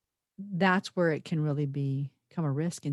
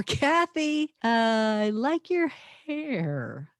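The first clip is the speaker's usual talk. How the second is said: high "Kathy", then "I like your hair" dragged out high, rising on "hair"; different emphasis; 5 semitones higher, much slower than usual. Shown as a demonstration. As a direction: stretch "I like your hair" very slowly, pitched up, questioning "hair". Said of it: "total different emphasis on the same words".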